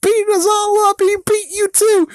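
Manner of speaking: very high, loud cartoon yell; squeaky high-pitched shouted warning; Michelangelo yelling. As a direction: screech it out like a panicked turtle. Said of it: "and he's like".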